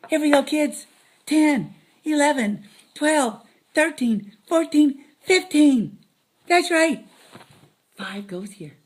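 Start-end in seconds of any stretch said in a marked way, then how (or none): none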